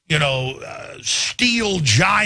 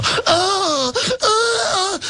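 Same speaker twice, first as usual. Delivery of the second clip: high, whimpering mock fright; mock scared cry. Crowd mocked as frightened in a mock scared cry. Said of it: "They go".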